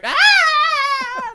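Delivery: sharp high shriek; startled shriek. Real, not acted. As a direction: yelp in startled surprise. Sudden cry on camera shake.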